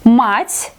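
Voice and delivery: "Мать" with a hard м and open a, rising; hard consonant. Shown as a demonstration. Teacher explaining mother, hard Мать. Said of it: "pronounce it like hard consonant".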